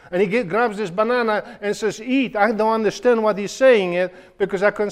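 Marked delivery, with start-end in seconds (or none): 2.0-2.3 high, friendly coaxing voice; soldier coaxing. Retold or imitated. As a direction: urge the boy kindly to eat. Soldier voiced offering food.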